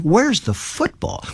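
high, drawn-out bewildered question; baffled complaint. Retold or imitated.

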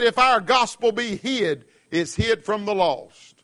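slow, weighty reading of Paul; scripture quote. Acted. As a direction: lean on each word of the verse. Quoting scripture slowly.

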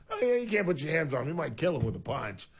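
hammed-up outraged parent to cop; lightly voiced indignant mother; mock parent. Voicing imagined parent's reaction.

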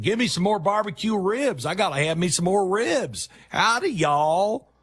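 high, hammed-up Texan voice; mock Texas drawl. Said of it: "where did you get the drawl from".

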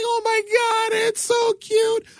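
mock baby-talk squeal over the kitten; mock cooing. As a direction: coo like a sappy news anchor, sarcastically. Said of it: "I'm like".

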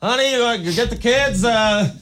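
high, soft, slowed neighbor voice; whisper-gossip like a nosy neighbor. Retold or imitated. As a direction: neighbor couple peeking out, high and hushed. Imitating neighbors watching him.

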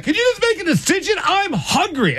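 very high, fast, whiny demand; squeaky, escalating complaint; mock exasperated woman. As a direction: imitate a hungry partner losing patience. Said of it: "And then just escalates".

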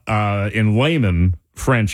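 ordinary talk, nothing marked

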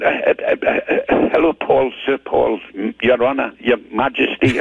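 stammering, fumbling retold greeting; tongue-tied. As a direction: imitate yourself lost for words, tripping over titles. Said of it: "I was absolutely tongue-tied".

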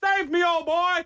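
high, slow shout after the dog; hammed-up rescue cry; mock heroic cheer. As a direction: cheer it off like a melodrama. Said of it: "I'm like".

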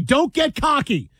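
high, slow mock admonishment; mock warning. Host quoting his colleague's warning back at him, mock admonishing.

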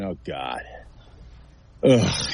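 low, deflated groan; acted dread. Analyst voices an O-lineman facing Garrett twice, acted dread. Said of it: "you're probably sitting there saying".

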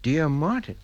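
puzzled, questioning rise on Martin; baffled rereading. Acted. Father finds the letter addressed to himself, incredulous questioning. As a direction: read it back in disbelief, lifting at the end.

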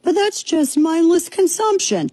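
hammed-up scolding critic; mocking critic voice. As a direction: parody a preachy critic in a put-on voice. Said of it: "That's what a communist would say".

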